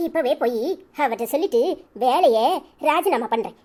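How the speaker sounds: usual speaking voice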